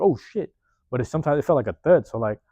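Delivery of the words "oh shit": a higher, jolted oh shit; mock startled yelp. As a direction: react like a sudden pop to the head. Average person reacting to hit.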